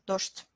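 docht with sh before the t; devoiced consonants. Shown as a demonstration. Teacher explaining how it is pronounced here, devoiced consonants. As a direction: say "docht" as dosht, both consonants voiceless. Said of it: "Ici, on prononce comme ch".

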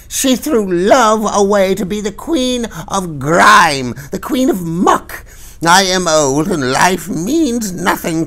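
high, loud crone voice, slow and dragging; ranting old crone. A narrator's reading. Old woman denouncing the Queen to the crowd, high ranting crone voice. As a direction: denounce her to the whole crowd. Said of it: "the old woman would not be quieted".

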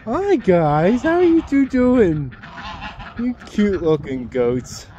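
high, sing-song voice for the birds; pet voice. Real, not acted. Farmer greets her ducks in a high baby-talk voice. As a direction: coo at the animals like they're babies.

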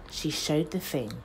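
usual speaking voice

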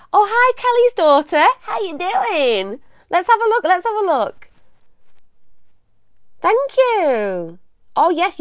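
light up at a familiar name; excited greeting. Real, not acted. Greeting a viewer's daughter in chat, bubbly and high.

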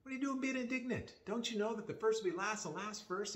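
high-pitched, lightly acted appeal to the group; a hint of acted remonstrance, pitched high; Jesus. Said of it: "Jesus addressed it. He goes".

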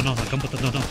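ordinary talk

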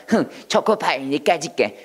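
scornful huff at a rival; acted contempt. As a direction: play a pie looking down on another. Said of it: "초코파이를 막 경멸하는 파이". (깔보며 경멸하는 연기 투)

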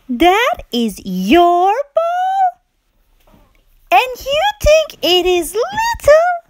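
very high, amazed little pig; astonished piggie. Acted. Pig amazed at the whale, very high. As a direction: squeak in disbelief.

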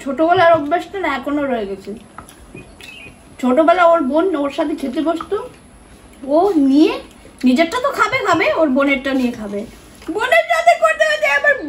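chewing while talking; words muffled by food; mouth partly full. Real, not acted. Seen 1: She talks between bites, cheeks and lips moving as if chewing.

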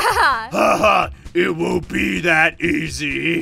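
slow, low villain taunt after a cackle; put-on menacing voice with an evil laugh; gloating villain.

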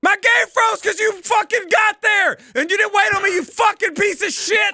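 high-pitched furious shouting; angry rant. Real, not acted. Blaming teammate for freeze.

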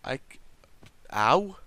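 ordinary delivery

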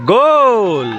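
excited goal call; goal shout. Real, not acted. Goal scored.

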